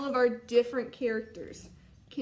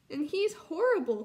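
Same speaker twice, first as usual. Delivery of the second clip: higher, mildly distressed little cub; upset cry; 5 semitones higher, 5 dB louder than usual. Acted. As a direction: cry it out, lightly acted. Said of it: "cried Ronnie".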